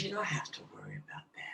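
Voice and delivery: soft hushed whisper, gently comforting; whispered reassurance. Retold or imitated. Reader reassuring a grieving daughter, whispered. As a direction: breathe it like a secret comfort.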